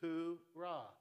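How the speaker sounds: Purah said slowly, POO-rah, falling; pronouncing Purah. Shown as a demonstration. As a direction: say Purah carefully. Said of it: "That's how you pronounce it".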